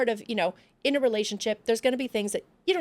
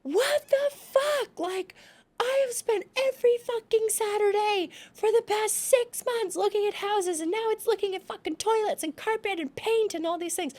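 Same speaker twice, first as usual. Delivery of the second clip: retold outburst; 13 semitones higher than usual. Retelling losing it at her boyfriend, shrill furious rant. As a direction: lose it at boyfriend, voice way up. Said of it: "I was like / And I lost it".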